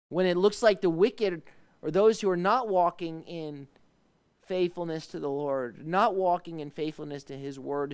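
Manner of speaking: preaching at full force. Acted. Pastor pleads with God before the congregation, preaching loudly.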